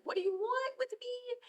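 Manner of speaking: high, panicked question; terrified plea. Retold or imitated. Boy faces the one-eyed man, high frightened plea. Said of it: "So he's like".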